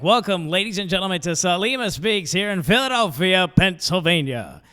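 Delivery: booming arena announcer welcome. Shown as a demonstration. Comic showing off his announcer voice to the crowd, arena announcer style. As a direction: roll it out like a basketball PA announcer. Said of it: "See, I do voices like that".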